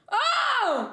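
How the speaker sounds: a sudden high shout; startled shout. Real, not acted. Streamer cries out as she grabs the worm. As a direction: cry out in surprise.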